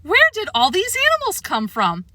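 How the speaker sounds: high, outraged question; shocked official; indignant shock. A narrator's reading. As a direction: demand it in disbelief. Helen shocked at animals.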